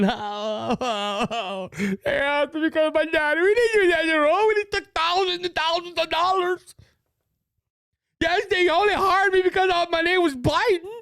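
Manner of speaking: high whiny mock crybaby voice; crybaby Hunter. Mocking Hunter Biden at the microphone, whiny high voice. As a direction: whimper it like a spoiled child. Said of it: "this crybaby behind a microphone".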